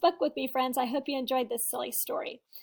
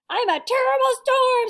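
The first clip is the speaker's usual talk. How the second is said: high, loud wild yell; 9 semitones higher, 10 dB louder than usual. A narrator's reading. Sammy getting carried away in the dance, loud high yell. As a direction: roar like a storm. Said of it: "Sammy yelled".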